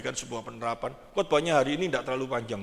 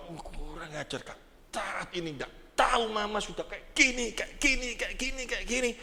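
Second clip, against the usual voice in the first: scolding mother; 4 semitones higher, 5 dB quieter than usual. Retold or imitated. Mother scolding a naughty child.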